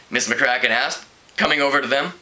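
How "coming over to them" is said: usual speaking voice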